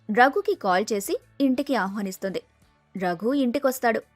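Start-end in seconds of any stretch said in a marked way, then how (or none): none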